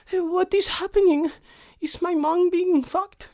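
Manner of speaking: put-on whisper-ask like a curious kid at the door. Kids listening at the door.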